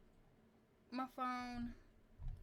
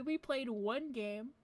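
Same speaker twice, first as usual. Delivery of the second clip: usual speaking voice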